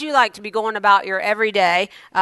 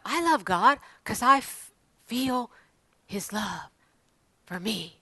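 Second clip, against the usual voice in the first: softer and softer, mock dreamy; sentimental sigh; 8 dB quieter than usual. Pastor mocking churchgoers, a mock dreamy fading murmur. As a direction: fade into a syrupy feeling. Mocking shallow love of God.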